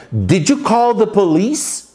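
Did you call the police, spoken slowly and clearly; careful slow teacher question; slow model question. Shown as a demonstration. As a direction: read it slowly for learners. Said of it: "Quer ver?".

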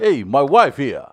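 loud, hammed-up New York British mix; muddled mock New York British accent. Comic retrying the line for co-hosts, New York British accent. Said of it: "That was maybe in, like, my New York British accent".

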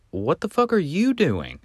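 high-pitched mimicry of an affronted man; imitated angry retort. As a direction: throw the question back in a mock-indignant high voice. Old man snaps back in story.

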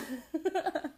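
high embarrassed giggle; nervous giggle. Real, not acted. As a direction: laugh it off awkwardly. Nervous laugh after cursing.

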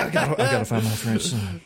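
botched impression, pitched well down; Nicolas Cage. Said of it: "my McConaughey always devolves into a cage".

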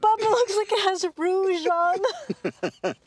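high, loud, helpless laughter through the words; cracking up at your own joke; laughing hard. Real, not acted. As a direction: barely get it out for laughing. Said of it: "Why are you laughing?".

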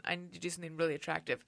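normal talking voice

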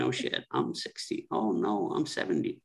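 usual speaking voice

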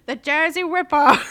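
put-on loud, high, botched Jersey accent; badly faked Jersey twang; awful Jersey accent. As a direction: belt out a hammed-up Jersey accent. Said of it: "I don't even know what that accent is".

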